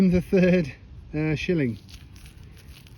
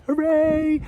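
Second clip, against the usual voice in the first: cheer; 10 semitones higher than usual. Real, not acted. Cheering a find's finder.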